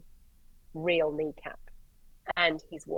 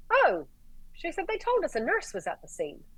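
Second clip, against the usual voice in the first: higher, lightly voiced nurse; a hint of a bright nurse; voicing the nurse; 11 semitones higher than usual. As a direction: play the chirpy nurse, lightly.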